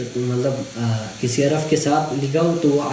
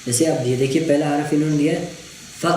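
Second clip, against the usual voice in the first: usual speaking voice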